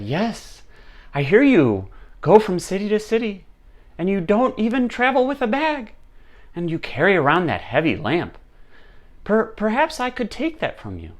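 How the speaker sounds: high-pitched, coaxing king; wheedling king. Acted. King angling for the monk's lamp, wheedling. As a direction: angle for it with feigned concern, voice up high.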